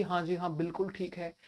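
low, hushed, compliant yes; meek agreement. Acted. Teacher voicing traditionalists agreeing to everything, meek and quiet. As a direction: drop the voice right down and go along with it.

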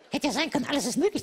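normal talking voice

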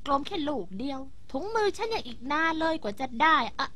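normal talking voice

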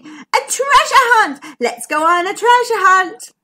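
high, loud shouting of a keen adventurer; excited shout. A narrator's reading. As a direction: shout it out with glee. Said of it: "McMeer jumped out shouting".

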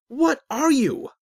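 loud, high, drawn-out yell; exasperated, angry demand; angry shout. A narrator's reading. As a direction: shout it slowly in rising impatience. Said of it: "Azric shouted, growing impatient and angry".

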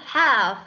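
"have" with the ending v sounded; teacher model of "have" with final v. Shown as a demonstration. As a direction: finish "have" on an audible v. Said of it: "âm V đằng sau ấy".

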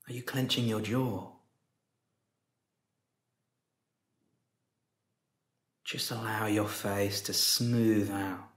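soft, unhurried meditation voice with long pauses; guided meditation. Real, not acted. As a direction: guide them gently into stillness, softly, leaving space after each phrase.

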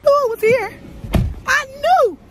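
very high, loud squeal; vindicated shriek. Real, not acted. Driver who knew the glove was here, vindicated shriek. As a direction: shriek it, completely sure of yourself.

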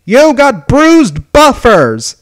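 high, very loud, drawn-out scream. A narrator's reading. A voice suddenly screams an insult, high and drawn out. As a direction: scream each word slowly, high-pitched. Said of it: "Suddenly, a voice screamed".

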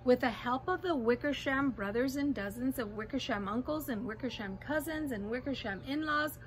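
ordinary delivery, nothing marked

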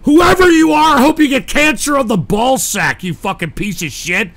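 real rage shouted at a caller; furious rant. Real, not acted. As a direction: tear into him at full volume. Raging at insulting caller.

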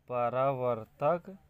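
परावर्तक stretched out syllable by syllable; drawn-out परावर्तक, ending on a rise; slow dictation. Shown as a demonstration. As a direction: dictate परावर्तक slowly as if writing it. Dictates term while writing.

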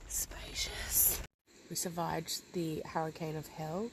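whispered ironic echo; whispering. Acted. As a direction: breathe the word softly, mocking.

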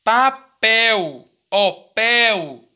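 "Papel" slowly in syllables, then "pel" alone, drawn out; stress on PEL. Shown as a demonstration. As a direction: break up "Papel" and lean on "pel". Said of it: "ela foi a sílaba mais forte". ("Papel" silabado, com "pel" forte e alongado)

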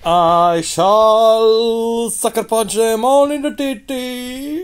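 high, loud, slow hammed-up villain; theatrical mock-evil vow. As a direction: proclaim it like a ham supervillain. Mock grand villain declaration.

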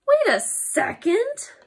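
high, slow, rising objection; drawn-out high double take; incredulous. Acted. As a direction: stretch it out in disbelief. Reader balks at book's silly words.

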